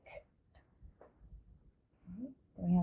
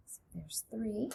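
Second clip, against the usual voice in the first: whispered aside while counting; 14 dB quieter than usual. Shown as a demonstration. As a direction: whisper the number softly. Teacher counting dots.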